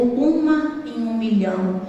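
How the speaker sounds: low, slow, weighty pronouncement; emphatic answer. Retold or imitated. Doctor answering patient's question, emphatic answer. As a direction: give the odds slowly and low.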